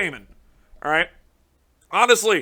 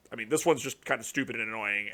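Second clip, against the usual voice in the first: ordinary delivery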